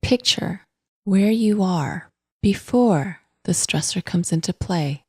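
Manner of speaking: calm, unhurried meditation voice with long pauses; guided meditation. Acted. Podcast host guiding listeners to notice breath and body, calm meditation voice. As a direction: guide gently, in even, spacious phrases.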